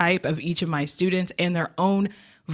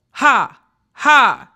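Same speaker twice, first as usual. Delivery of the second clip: loud, high, separate "ha" bursts; pretend laugh; 6 semitones higher, 9 dB louder than usual. Shown as a demonstration. Voice coach demonstrating a diaphragm exercise, pretend laugh. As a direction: punch out each mock-laugh ha from the belly. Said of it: "when you go".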